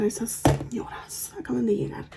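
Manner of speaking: whispered Spanish gossip; conspiratorial, breath-only confiding; secretive whisper. Real, not acted. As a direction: keep it hushed so relatives don't hear. Gossiping about relatives within earshot.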